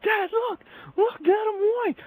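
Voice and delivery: very high, mock-excited child squeal; still-happy kid. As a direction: show dad like an over-eager little boy. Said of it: "kinda pissed but still happy".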